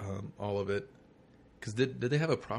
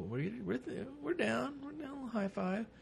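high, small voice of chummy approval; mock chummy nod; 6 dB quieter, slower than usual. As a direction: squeak it softly, like a little cheer to fans. Show winking at comic fans.

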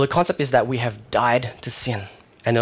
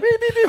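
high squeaky beep-beep honks; imitated car horns; 4 dB louder than usual. Cars behind the stalled learner, high mock horns. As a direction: mimic impatient horns, way up high.

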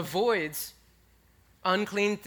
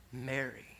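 soft, hushed, lower and tender; tender name call; 6 semitones lower, 8 dB quieter than usual. Acted. Jesus gently calling Mary by name, hushed and tender. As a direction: breathe her name like a beloved friend.

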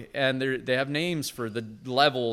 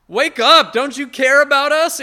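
shouting high and loud, panicked; desperate cry; 14 semitones higher, 14 dB louder than usual. Acted. Disciples in the storm shouting at sleeping Jesus, panicked. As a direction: cry out for help like drowning men. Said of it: "they cry out".

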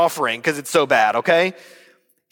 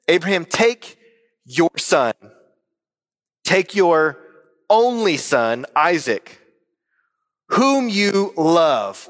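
"your son", "only son", "Isaac" set apart by long pauses, ending on a drawn-out "whom you love"; three-fold emphasis; slower than usual. Acted. God testing Abraham, deliberate emphasis on "son", "only son", "Isaac", "whom you love". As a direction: weigh each phrase, pausing before "take your only son" and stretching "whom you love". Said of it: "Notice the three-peat emphasis here".

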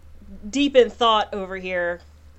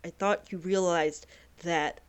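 heartbroken wife; 2 semitones lower, 8 dB quieter than usual. Acted. Salemla telling her wife she thought she understood, hurt and soft. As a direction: say it quietly, betrayed. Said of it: "So she's like".

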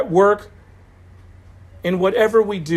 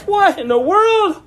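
thrilled disbelief; 7 semitones higher than usual. Retold or imitated. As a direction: pitch soaring high, slowing on what in the world. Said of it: "I'm so blessed".

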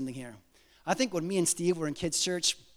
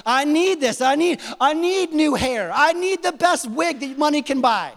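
mock demanding prayer; 6 semitones higher, 4 dB louder than usual. Pastor mocks unbiblical prayers.

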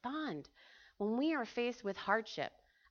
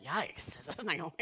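"Yikes, is this Naomi?" with mock shock, lower and softer; offended surprise; 6 semitones lower, 5 dB quieter than usual. Shown as a demonstration. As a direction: act a little horrified at "Naomi". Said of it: "a little offensive".

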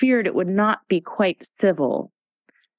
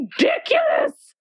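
very high scornful queen's cry; cried out. A narrator's reading. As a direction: fling the word up in outrage. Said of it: "cried the Queen".

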